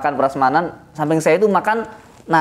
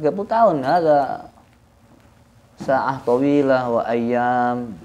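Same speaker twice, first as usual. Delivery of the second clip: ordinary talk, nothing marked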